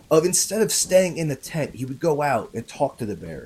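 plain reading voice

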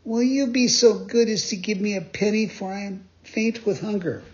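put-on high, pleading puppet-boy voice; a high boyish plea, ashamed; shamefaced begging boy. A narrator's reading. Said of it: "said to him with eyes downcast in shame".

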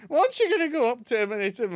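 fast, high, loud Yorkshire nagging; Yorkshire accent. Acted. Wife urges her husband to confront the man, Yorkshire accent. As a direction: goad him in rapid Yorkshire. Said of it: "the Yorkshire accent really makes it".

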